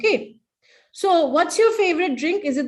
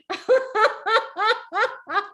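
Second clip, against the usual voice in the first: high rolling laugh; high staccato ha-ha laugh; exercise laugh; 8 semitones higher than usual. Shown as a demonstration. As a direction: laugh in a long, even run.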